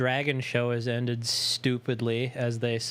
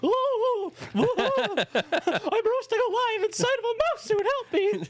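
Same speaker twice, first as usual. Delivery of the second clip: Minnie Mouse voice. Retold or imitated. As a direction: squeal like a cartoon mouse in distress. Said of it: "Minnie Mouse and just be like".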